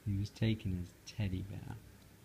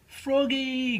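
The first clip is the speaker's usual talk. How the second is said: much higher, louder call; 13 semitones higher, 5 dB louder than usual. Acted. Mother calling Froggy back, loud call. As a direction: call him from across the house. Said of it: "called his mother".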